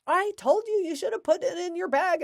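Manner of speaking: hammed-up I-told-you-so; arguing parent. Parent arguing with kid over forgotten homework, mock scold. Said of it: "start arguing with them".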